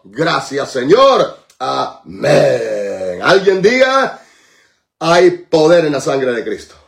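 low, drawn-out prayerful declarations; reverent praise. Real, not acted. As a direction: sink into deep worship, swelling on key words. (alabanza solemne con voz grave)

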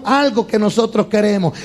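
ordinary talk

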